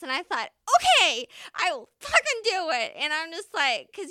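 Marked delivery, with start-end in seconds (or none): 0.7-2.9 high, loud self pep talk; psyched up. Retold or imitated. Paramedic daring herself to drive solo, psyched up and loud. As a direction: pump yourself up out loud.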